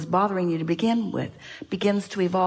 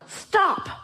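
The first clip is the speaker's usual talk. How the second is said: mock yell; 13 semitones higher than usual. Yelling at a skier tumbling down the slope, mock yell. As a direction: call it out high and sudden. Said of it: "you just yell".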